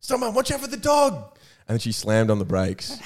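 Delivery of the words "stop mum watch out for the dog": high, urgent mock panic shout; fake alarm. Son fakes a warning to Mum driving, high urgent mock panic. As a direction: cry out a pretend warning to swerve. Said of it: "And I went".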